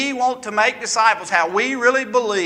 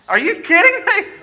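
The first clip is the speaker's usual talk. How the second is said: incredulous; 11 semitones higher, 4 dB louder than usual. Retold or imitated. Retelling his reaction to the blog.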